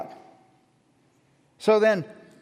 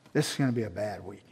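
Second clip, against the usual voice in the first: much lower, much softer, fast and defeated; weary groan; 14 semitones lower, 10 dB quieter, faster than usual. Retold or imitated. Pastor telling his wife it'll be a bad week, low defeated mumble. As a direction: sag into a beaten mumble.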